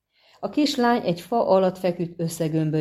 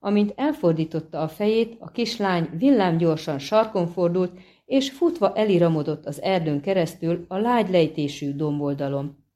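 plain reading voice

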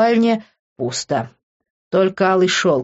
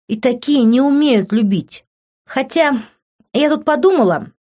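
higher, scornful; bitter mockery; 6 semitones higher than usual. A narrator's reading. Liv mocks Vincent and the narrator with scorn. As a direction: sneer at both of them.

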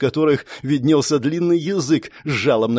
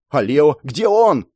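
slow, emphatic cried question; shouted question; 3 dB louder, slower than usual. A narrator's reading. Hero asks after another friend, slow emphatic shout. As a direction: demand it with drawn-out urgency. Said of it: "прокричал я".

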